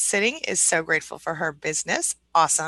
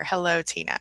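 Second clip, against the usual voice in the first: normal talking voice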